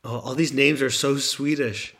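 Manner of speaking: normal talking voice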